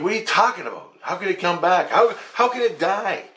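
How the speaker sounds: high-pitched, incredulous protest; exasperated disbelief. Retold or imitated. Dreamer arguing with Jesus about the dead dog, high and incredulous. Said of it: "And I'm like".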